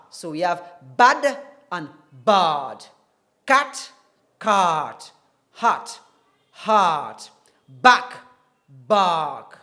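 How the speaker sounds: loud drilled vowel pairs. Shown as a demonstration. Teacher drilling bad, cat and back aloud. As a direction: call out each pair clearly. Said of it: "short R and the long R".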